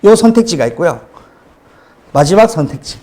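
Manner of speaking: ordinary delivery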